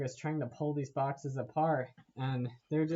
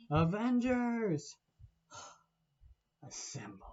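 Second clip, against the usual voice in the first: slow, raised 'Avengers' then a sighed, whispered 'assemble'; drawn-out call fading to a whisper; 7 semitones higher, slower than usual. Retold or imitated. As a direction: start grand, then let it deflate into a whisper. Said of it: "And so it's like".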